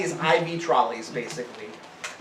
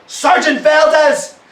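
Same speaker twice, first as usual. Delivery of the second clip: a frantic doctor yelling at her sergeant; 11 semitones higher, 9 dB louder than usual. A narrator's reading. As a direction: shout the order at the soldier in alarm. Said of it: "she yells out".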